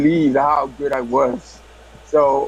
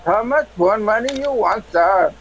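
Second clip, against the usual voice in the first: high-pitched mock Indian accent; 5 semitones higher than usual. Prank caller answers a call from India, Indian accent. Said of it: "It's from India".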